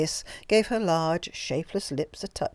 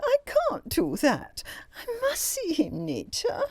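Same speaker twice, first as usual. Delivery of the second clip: much higher, slow, crying protest; tearful wail. A narrator's reading. Greta refusing Anita, high and tearful. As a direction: keep wailing through tears. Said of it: "wailed the other".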